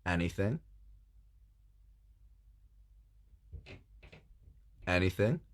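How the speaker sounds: a hint of suggestive frog, rising at the end; creepy frog. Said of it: "The frog looks at her and goes".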